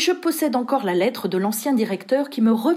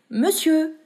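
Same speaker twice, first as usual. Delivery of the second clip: servant's wake-up call, already pitched higher; first of rising calls; 7 semitones higher than usual. A narrator's reading. Joseph waking his master, first call, raised pitch. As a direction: start the climbing call a step up. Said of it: "avec une progression chromatique de la voix".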